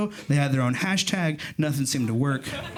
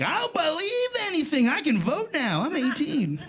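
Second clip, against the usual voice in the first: high, eager naive blurting; 11 semitones higher than usual. Retold or imitated. Teen self believing the lightning story, high eager blurt. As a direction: sell it like a gullible kid. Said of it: "and I was like".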